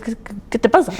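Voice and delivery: stammered high, incredulous "qué te pasa"; indignant retort. Retold or imitated. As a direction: confront a yelling adult, mock indignant. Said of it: "claro, le digo". (reclamo incrédulo, más agudo)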